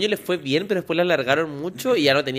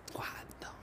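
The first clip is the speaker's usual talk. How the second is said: susurro sin voz; whisper; 22 dB quieter than usual. Real, not acted. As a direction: dilo en secreto, sin voz.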